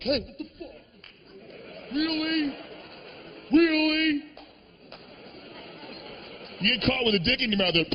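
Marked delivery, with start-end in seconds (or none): 0.0-4.2 hammed-up outrage; mock indignant surprise. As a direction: act offended at being walked in on. Caught person acting outraged.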